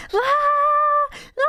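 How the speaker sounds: high, drawn-out mock scream; imitated crowd scream. Player imitating the other players screaming, mock scream. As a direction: wail like the screaming masses. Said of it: "a general screaming sound of the masses".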